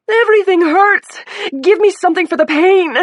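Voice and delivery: high, loud desperate yelling; pained scream. A narrator's reading. Injured woman begging doctors for pain relief. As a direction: beg loudly for relief.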